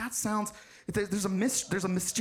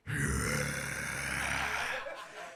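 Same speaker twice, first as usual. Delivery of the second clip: long, very low creaky groan; drawn-out low sigh; 5 dB quieter than usual. Real, not acted. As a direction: groan wearily before explaining. Long noise before explaining the term.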